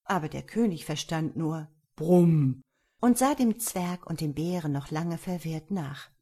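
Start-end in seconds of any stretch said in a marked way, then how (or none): 2.0-2.6 loud imitated bear rumble; bear growl. A narrator's reading. King hears only bear's growl.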